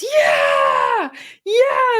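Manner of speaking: high, loud cheering yes; cheering shout. Retold or imitated. Group cheering a member's confessed failure, loud whooping shout.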